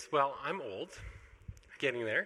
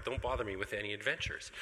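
usual speaking voice

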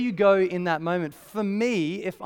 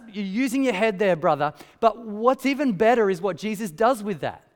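ordinary talk, nothing marked

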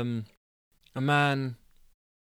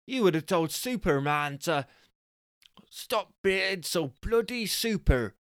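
high exasperated wife's voice; high mocking lecture; scolding wife; 5 semitones higher than usual. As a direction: tease and scold him, pitched up.

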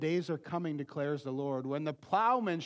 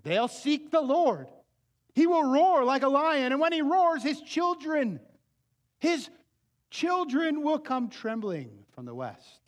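higher, louder, building roar; preacher voicing the lion's roar; fervent scripture proclamation; 9 semitones higher, 7 dB louder than usual. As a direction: thunder the verse out. Reads God's roar aloud.

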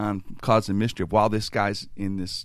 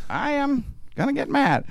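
nervous. Retold or imitated. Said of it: "I was nervous".

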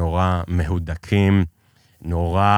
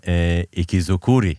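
ordinary delivery

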